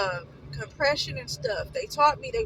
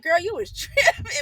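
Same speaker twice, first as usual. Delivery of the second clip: laughing tease; 6 semitones higher, 6 dB louder than usual. Retold or imitated. Ex-boyfriends mocking her.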